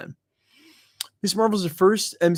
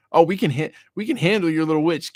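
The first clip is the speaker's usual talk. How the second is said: cocky mock voice, a bit higher; mocking boast; 5 semitones higher, 5 dB louder than usual. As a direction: sneer it like an overconfident hero.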